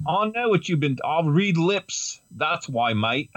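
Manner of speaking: put-on high playful Cockney accent. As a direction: Hal as a Cockney geezer. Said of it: "do his voice in a Cockney accent".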